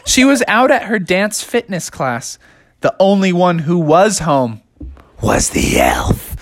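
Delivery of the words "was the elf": slow, low, eerie whisper; whispered spooky reveal. A narrator's reading. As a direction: hush it like a ghost story.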